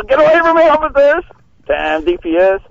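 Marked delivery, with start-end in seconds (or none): 0.0-1.2 high-pitched pretend-panicked call; mock alarmed shout. Prankster running from police, mock alarmed shout. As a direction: call out as if fending off pursuers. Calls out while fleeing police.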